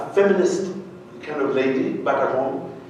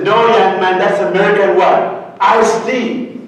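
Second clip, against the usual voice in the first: louder, higher brash correction; mock loud American scolding; brash American woman; 4 semitones higher, 5 dB louder than usual. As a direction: set him straight like a loud Pittsburgh lady. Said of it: "She says".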